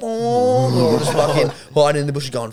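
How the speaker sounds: usual speaking voice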